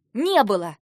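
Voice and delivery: loud, high defensive outburst; heated full-voiced denial; yelling. A narrator's reading. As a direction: shout excuses in protest. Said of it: "заорала я".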